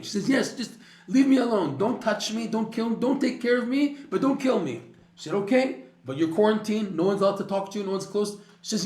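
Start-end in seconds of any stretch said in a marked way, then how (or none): 0.3-4.8 much higher, louder panicked pleading; cling to life, begging in a high panic; frantic begging. Retold or imitated. Sick woman pleads with the doctors to spare her, panicked. 5.3-8.4 much higher, louder curt orders; stern doctor. Retold or imitated. Doctor orders the woman into quarantine, curt and stern.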